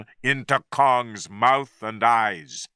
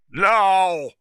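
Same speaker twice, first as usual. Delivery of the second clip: sudden order; 7 semitones higher, 4 dB louder than usual. A narrator's reading. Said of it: "turned to his men and said".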